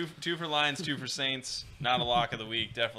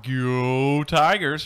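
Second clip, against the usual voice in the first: drawn-out cheer; 5 semitones higher, 7 dB louder, much slower than usual. Acted. Cheering on his college team.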